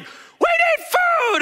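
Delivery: high-pitched, drawn-out cry for food; desperate shout. Acted. Disciple shouting to Jesus for food. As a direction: wail it like a starving man.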